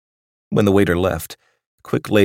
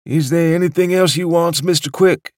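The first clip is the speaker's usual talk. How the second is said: put-on much higher, gentle old waiter voice; a doddering old waiter offering more; sweet old waiter; 12 semitones higher, 5 dB louder than usual. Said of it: "sweet, doddering old waiter".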